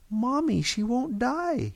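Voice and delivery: put-on very high, drawn-out child's whine; yelling little boy. A narrator's reading. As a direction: pipe up as a boy complaining to mom. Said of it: "he yelled".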